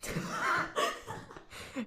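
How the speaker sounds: high-pitched giggling; real high laugh; laughter. Real, not acted. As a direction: giggle at the muddle. Laughing at left-right confusion.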